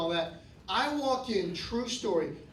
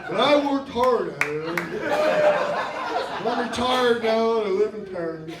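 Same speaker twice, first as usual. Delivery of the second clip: smug retiree; 5 dB louder than usual. Acted. Continuing the audience member's voice.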